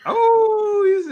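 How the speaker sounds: long, high, loud "oooh"; a big teasing reaction to the pick; playful surprise. Real, not acted. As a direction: swoop way up in pitch and hold it.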